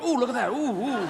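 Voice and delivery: high, excited oohing; eager beaver. Acted. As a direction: play an eye eagerly spotting everything. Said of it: "one eager beaver eye going".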